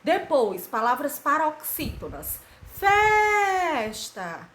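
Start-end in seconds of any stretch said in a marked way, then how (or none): 2.7-4.5 "Festa" called high and loud, FES held long; chanted "Festa" with a long, high first syllable; stress on penultimate syllable. Shown as a demonstration. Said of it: "a sílaba mais forte é a penúltima".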